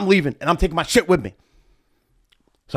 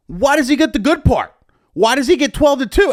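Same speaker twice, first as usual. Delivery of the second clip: high indignant complaint; indignant protest; 12 semitones higher, 4 dB louder than usual. Retold or imitated. DJ protesting to the promoter about his time slot, high indignant whine.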